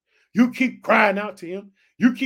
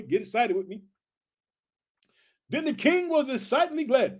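usual speaking voice

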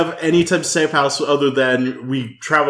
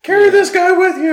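high character voice; 14 semitones higher than usual. Acted. Character giving orders.

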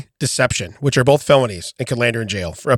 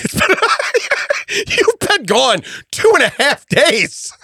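laughing; 11 semitones higher than usual. Real, not acted. Laughing through words.

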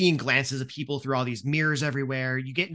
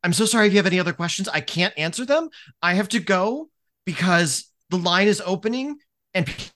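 fast, higher, flustered babble; rushed nervous apology; 4 semitones higher than usual. Acted. Nervous student rushing away from the coordinator, fast and flustered. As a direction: blurt it all out and flee. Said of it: "kind of looks at you and goes".

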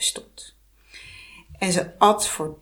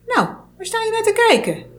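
high, sharp hag; snappish witch; 5 dB louder, faster than usual. Acted. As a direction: snap at the staring girl. Heks snauwt starend meisje af. (hoge, bitse heksenstem)

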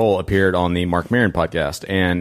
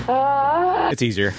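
high, slow, hammed-up crooning voice; golden-throated voice; much slower than usual. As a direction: drawl it like a smooth crooner. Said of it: "my natural golden-throated accent".

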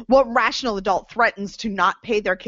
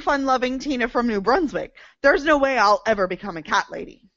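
ordinary talk, nothing marked

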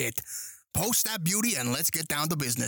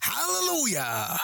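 church hallelujah; 9 semitones higher than usual. Pretend. Mock worship reply to speech.

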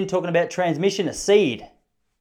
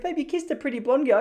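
much higher voice for the wife; mock wife voice; 11 semitones higher than usual. Wife accusing him, higher mock voice. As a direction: play the wife, pitched up. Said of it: "she goes".

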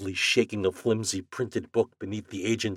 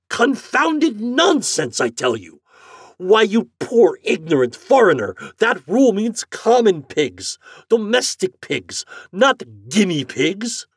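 higher, loud, slow insistence; furious customer; 14 semitones higher, 9 dB louder than usual. Acted. Morehouse scolding the agent, furious. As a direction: pound every word.

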